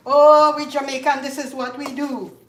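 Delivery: mock complaining. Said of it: "like, complaining".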